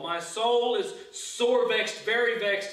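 loud, slow cry of the soul; anguished lament. Acted. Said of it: "we can feel it in our soul".

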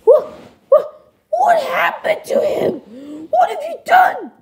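very high falsetto outcry; high-pitched, appalled puppet voice; shocked parent. Acted. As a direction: squeal in alarm at what became of your child. Parent alarmed at changed son.

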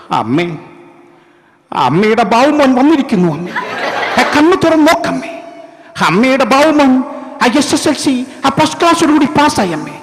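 hammed-up high melodramatic son; Prem Nazir impression. Son returning to his dying mother, old-film melodrama parody. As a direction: cry out to mother, building high then stretching and sinking. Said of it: "അന്നത്തെ അദ്ദേഹത്തിൻ്റെ style".

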